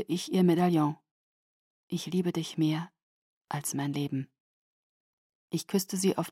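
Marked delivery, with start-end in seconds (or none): 1.9-4.3 soft unvoiced farewell whisper. A narrator's reading. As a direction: breathe it tenderly.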